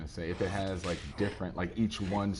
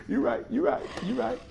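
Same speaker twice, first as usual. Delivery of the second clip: laughing; 4 dB louder than usual. Real, not acted. Laughing at chat's joke.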